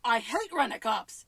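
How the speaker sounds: imitated disgust, building to a loud strain; voice-straining quote. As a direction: push the second line until the voice strains. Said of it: "losing my voice just saying that".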